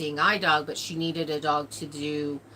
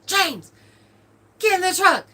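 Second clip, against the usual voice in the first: high, loud mock yell; retold yell; 13 semitones higher, 7 dB louder than usual. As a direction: yell it across the house at her husband. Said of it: "So she's yelling".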